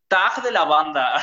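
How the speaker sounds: slow, drawn-out Mexican fresa voice, laughing; hammed-up fresa drawl through laughter; posh fresa voice. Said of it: "hablando súper fresco". (voz fresa exagerada, lenta y entre risas)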